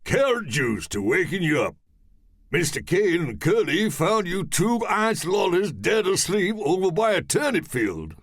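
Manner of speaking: high, cheery strongman explaining; jolly strongman voice. Acted. Mallow Man explaining.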